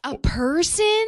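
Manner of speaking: loud, drawn-out rising disbelief; incredulous. Real, not acted. Co-host hearing the price per person, incredulous.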